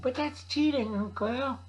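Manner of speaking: put-on high whining kid protest; grandnephew voice. Grandnephew protests the drill is cheating, high whiny voice. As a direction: complain like a little kid. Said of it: "my grandnephew said".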